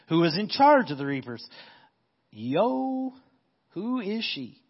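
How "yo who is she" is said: high, slangy, offhand; casual modern paraphrase. Acted. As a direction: toss it off like a guy spotting someone. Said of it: "[name] translation".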